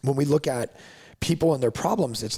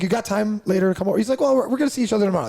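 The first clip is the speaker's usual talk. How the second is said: higher, fast, eager client voice; 10 semitones higher, 5 dB louder, much faster than usual. Retold or imitated. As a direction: rattle it off high and excited like the keen client.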